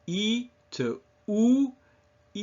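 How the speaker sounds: long E sliding into French U; E to oo glide. Shown as a demonstration. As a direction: hold E and round the lips toward oo. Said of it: "do that in the mirror".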